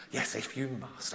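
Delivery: a touch of a weary husband giving in; husband sighing his consent to his wife; grudging husband. Retold or imitated.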